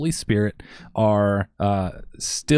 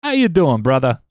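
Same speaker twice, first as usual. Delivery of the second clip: high, loud Chicago guy greeting; failed try at a Chicago accent; South Chicago accent; 6 semitones higher, 6 dB louder than usual. Retold or imitated. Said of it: "Yeah, I can't do it".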